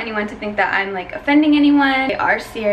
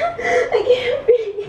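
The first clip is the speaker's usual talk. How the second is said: much higher, very slow mock gasping; staged panic attack, higher drawn-out wail; fake panic; 13 semitones higher, much slower than usual. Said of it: "then I'll start the panic attack".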